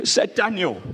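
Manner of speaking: ordinary delivery, nothing marked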